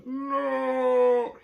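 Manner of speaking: hammed-up Vader scream of despair; Darth Vader no. Aaron seeing Lori stab herself, Vader-style howl. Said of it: "he Darth Vader's the".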